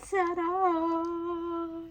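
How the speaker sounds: high, long drawn-out presenting flourish; ta-da reveal. Real, not acted. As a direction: sing out a big reveal. Showing off her purchase to viewers.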